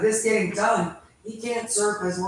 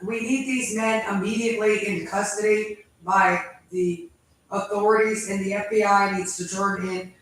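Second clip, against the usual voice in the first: ordinary talk, nothing marked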